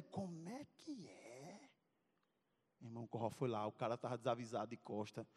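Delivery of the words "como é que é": a quiet dangerous question; hint of a tough guy's warning; menacing. Corró hears the news.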